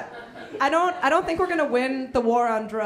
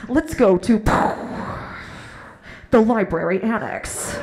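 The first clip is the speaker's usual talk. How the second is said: loud trailer reveal with a big pause; booming mock-epic narrator; movie trailer voice; 3 semitones lower, 6 dB louder than usual. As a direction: punch out the title like a trailer announcer. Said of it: "your life should be a movie trailer".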